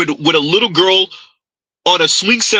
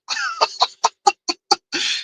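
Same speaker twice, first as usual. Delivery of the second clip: high-pitched mocking giggle; squeaky laugh; 6 dB quieter than usual. Real, not acted. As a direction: let out a high little laugh. Laughing at clip.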